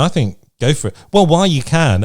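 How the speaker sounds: ordinary delivery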